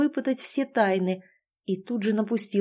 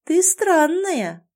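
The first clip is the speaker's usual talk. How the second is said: high, slowly stretched verdict; drawn-out wonder; 8 semitones higher, 4 dB louder, much slower than usual. Acted. Лана circles the heroine, marvelling in a drawn-out voice. Girl puzzles over the heroine.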